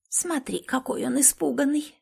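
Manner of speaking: put-on high, soft old crone whisper; old woman whispering. A narrator's reading. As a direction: whisper it like a gleeful old hag. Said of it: "прошептала другая старуха".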